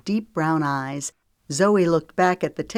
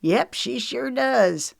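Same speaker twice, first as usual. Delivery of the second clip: proud; 8 semitones higher, slower than usual. A narrator's reading. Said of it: "Knox said proudly".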